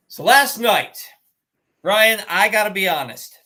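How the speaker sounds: normal talking voice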